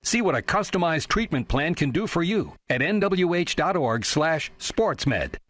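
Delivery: ordinary talk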